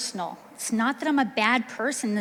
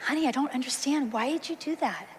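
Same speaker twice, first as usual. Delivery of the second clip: gentle voice; 3 semitones higher than usual. Shown as a demonstration. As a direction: ease into it kindly, no edge at all. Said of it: "So try to use a gentle voice".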